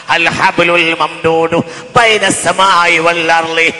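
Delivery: loud chanted sermon with drawn-out endings; sing-song preaching. Acted. Preacher reciting scripture to the congregation, chanted preaching. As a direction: preach it out, stretching the last syllables.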